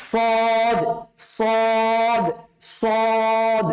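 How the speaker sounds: সদ drilled with heavy s and long held vowel; thick sad. Shown as a demonstration. Teacher drills the letter with students, heavy সদ. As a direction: stretch each সদ, thick and rounded. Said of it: "মোটা করে".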